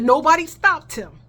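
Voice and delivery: mock-angry retold accusation; retold outburst. Retelling confrontation with onlookers.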